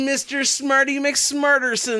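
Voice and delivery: high, mocking know-it-all voice; smug smarty-pants. Mocking the movie's exposition, smug smarty-pants. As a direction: sing out the silly boast. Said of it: "it says repeatedly".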